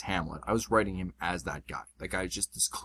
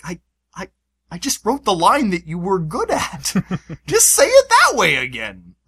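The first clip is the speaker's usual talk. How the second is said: exasperated; 8 semitones higher, 10 dB louder than usual. Retold or imitated. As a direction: plead with the actors in exasperation. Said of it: "I'm like".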